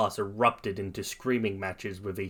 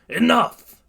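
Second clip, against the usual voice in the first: sharp, high, loud command; angry yell; 12 semitones higher, 8 dB louder than usual. A narrator's reading. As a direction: shut the whole room up. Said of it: "Aizawa angrily yells".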